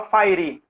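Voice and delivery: "fire" said as FAI-ree with a tapped R; "fire" as two syllables, "fai-ri"; Brazilianized mispronunciation. Shown as a demonstration. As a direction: say "fire" the Portuguese way, ending in -ree. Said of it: "eu também falava fire".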